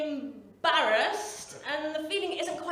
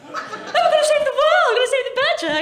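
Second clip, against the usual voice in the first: much higher and louder, gushing mock idealist; mock earnest twenty-something; 12 semitones higher, 12 dB louder than usual. Mocking fervent twenty-somethings saving the world, high gushing squeal. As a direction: squeal it like a zealous young activist.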